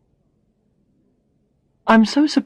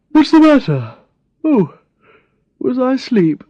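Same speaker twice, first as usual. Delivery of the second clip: startled awake; 6 semitones higher, 4 dB louder than usual. Acted. Brad just woken, startled.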